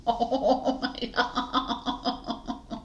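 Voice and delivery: squeaky giggling, very slow; breathless laugh. Real, not acted. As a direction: dissolve into giggles mid-word. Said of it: "laughing at flubbed take".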